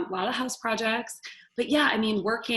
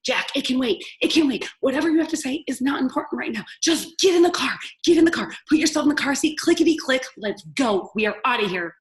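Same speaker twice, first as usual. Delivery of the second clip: loud, high, hurried orders; impatient shouting; 4 semitones higher, 8 dB louder than usual. Retold or imitated. Mom shouting at her toddler to get in the car seat, impatient. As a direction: bark at the kid to get in. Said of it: "Mom dismissing the child".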